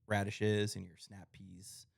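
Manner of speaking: ordinary talk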